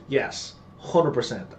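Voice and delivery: ordinary delivery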